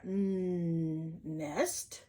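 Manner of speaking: "n-" held long before nest; N sound demo. Shown as a demonstration. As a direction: hum the "n-", then finish nest. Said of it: "starts with an N sound".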